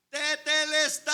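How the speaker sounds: a high, loud drawn-out cry of triumph; triumphant high shout from the cross. Retold or imitated. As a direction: shout it slowly, soaring high. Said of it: "y gritó así".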